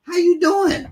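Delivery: bright, high, slow neighbourly hello; high mock-friendly hello; high friendly greeting. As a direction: call out a cheery high greeting. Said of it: "come outside and say".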